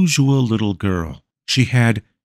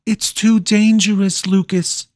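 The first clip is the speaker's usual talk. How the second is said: put-on much higher, girlish plea; pleading girls; 12 semitones higher than usual. A narrator's reading. Pretty girls beg Lucas not to go, high girlish plea. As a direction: cry it out as swooning girls. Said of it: "they would cry".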